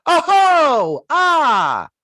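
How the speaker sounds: high, loud "oh-ho" then falling "ah"; exaggerated drawn-out reaction wail, imitating a close-up; mock reaction cries. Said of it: "close-up of Andy going".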